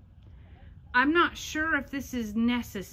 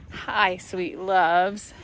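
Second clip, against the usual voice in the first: tender greeting; 6 semitones lower, 4 dB louder, much slower than usual. Real, not acted. Greeting the baby goats.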